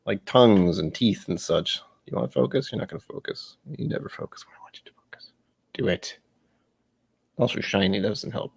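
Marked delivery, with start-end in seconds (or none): 2.1-6.2 soft whisper coaxing the camera; hushed grumble at a gadget. Real, not acted. Pleading with the camera focus.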